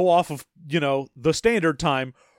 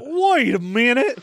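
put-on old prospector; 6 semitones higher than usual. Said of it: "he talks like an old prospector".

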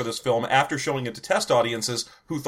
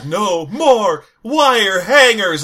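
slow, raging shout, higher and louder; furious shout; 6 semitones higher, 6 dB louder, much slower than usual. Retold or imitated. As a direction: bellow it out in slow fury. Quoting Joan Crawford's famous rage.

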